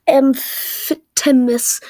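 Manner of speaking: slow stumbling try at Amphithemis; slow halting guess at Amphithemis; mispronounced name. Real, not acted. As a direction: drag out Amphithemis uncertainly, syllable by syllable. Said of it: "I slandered that".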